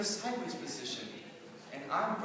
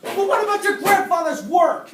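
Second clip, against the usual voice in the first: very loud, high, pressing question; shouted challenge; 8 semitones higher, 17 dB louder than usual. Acted. Student provokes Frankenstein about his grandfather, shouting. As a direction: yell it over his tirade.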